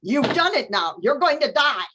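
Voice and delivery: lightly voiced furious ruler; angry governor. A narrator's reading. Governor threatening Carmelita, mildly angry. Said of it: "the governor got angry and red".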